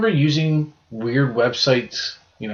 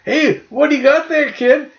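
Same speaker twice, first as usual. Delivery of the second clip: much higher, louder sing-song dad voice; dad asking the kid; 5 dB louder than usual. Retold or imitated. Retelling the dad questioning his son, high playful voice. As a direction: tease the kid like a playful father. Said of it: "He's like".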